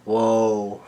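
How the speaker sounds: ordinary talk, nothing marked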